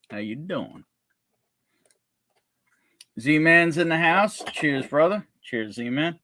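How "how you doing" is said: Joey-style greeting. Pretend. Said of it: "Well, you're a sexy man beast".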